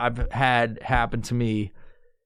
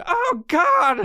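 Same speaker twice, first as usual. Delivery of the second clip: mock panic; 3 dB louder than usual. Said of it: "I was like".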